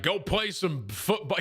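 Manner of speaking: slow mock order from a parent; imitated dad. Dad sending son out to play, lightly hammed-up order. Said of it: "He's like".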